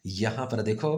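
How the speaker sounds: ordinary talk, nothing marked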